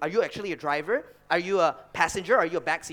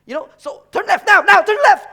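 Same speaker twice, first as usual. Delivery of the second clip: much higher, much louder mock shriek of turn left; mom yelling; 16 dB louder than usual. Son mimicking mom yelling directions at dad, hammed-up shout. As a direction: shriek the order like a panicking mom. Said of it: "She'd be like".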